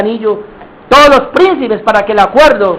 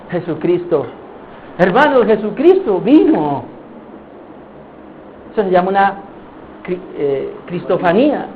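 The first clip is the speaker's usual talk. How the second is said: normal talking voice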